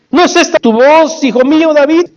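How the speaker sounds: high-pitched, wondering question, acted out; surprised recognition. Saúl wakes and knows David's voice, high surprised question. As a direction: ask it high, as if just recognizing someone.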